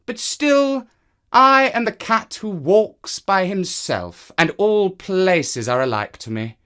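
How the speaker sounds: high, slow proud cat voice; the cat insisting on his freedom; defiant cat. Acted. Cat answering the man, high and defiant.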